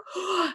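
sudden high startled gasp; sharp gasp. Retold or imitated. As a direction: gasp in panic. Panicking over lost message.

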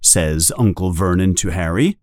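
usual speaking voice

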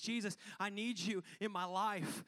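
high, rising fervent plea; pleading prayer. Acted. Acted prayer pleading with Jesus.

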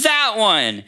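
put-on eager child pointing. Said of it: "she goes".

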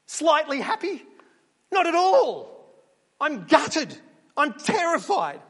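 high, loud, panicked sheep voice; panicked sheep shouting back at the interviewer; frantic sheep Keith. Pretend. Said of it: "He says".

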